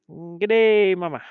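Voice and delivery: high, loud, playful mock Australian call; mock Australian greeting. Greeting a viewer from Sydney, mock Australian accent. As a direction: holler it like a hammed-up Australian.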